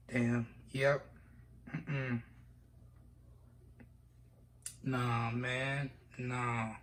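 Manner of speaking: low, very soft, overcome; holding back tears. Real, not acted. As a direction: murmur it low, fighting real tears. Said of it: "I'm trying not to cry".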